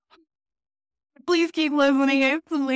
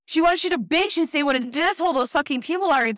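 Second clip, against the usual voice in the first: mock rant; 9 semitones higher than usual. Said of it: "I literally was like".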